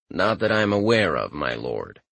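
low, flat deadpan servant voice. Acted. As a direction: keep it dry and toneless. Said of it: "in his deadpan voice".

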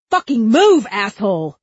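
high, loud burst, easing off; furious shout. A narrator's reading. Cop to Huck blocking his shot, furious shout. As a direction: explode at someone blocking you.

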